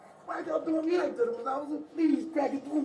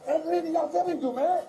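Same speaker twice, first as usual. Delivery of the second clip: loud, high, frightened pleading; panicked pleading; 4 semitones higher, 5 dB louder than usual. Real, not acted. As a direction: cry out in real panic. Arrested man panics, pleads loudly.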